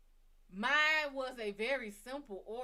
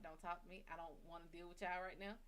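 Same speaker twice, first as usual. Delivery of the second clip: irritated mutter; 4 semitones lower, 10 dB quieter, faster than usual. Retold or imitated. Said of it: "I was like".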